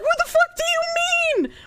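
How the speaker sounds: very high-pitched incredulous shriek; outraged squeal. Retold or imitated. Retold outraged reaction to ex.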